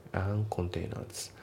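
slow "and containers" as "an containers"; dropped D. Shown as a demonstration. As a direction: leave the D out of "and containers", slowly. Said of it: "andのDが抜きますね".

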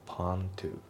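POND TO as "pan-tu"; dropped D. Shown as a demonstration. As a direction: go from the N of POND straight to TO. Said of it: "ポンドのDが抜けますね".

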